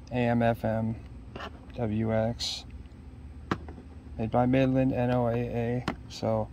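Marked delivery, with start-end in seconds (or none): none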